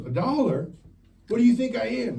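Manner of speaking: high, loud offended woman; mock outrage at the insult; hammed-up shocked lady; indignant woman. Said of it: "She goes".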